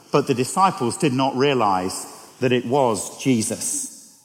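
ordinary delivery

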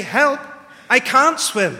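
loud, high yell for help; desperate shout. Shown as a demonstration. A drowning man crying out for rescue in a loud, high shout. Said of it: "when you're drowning".

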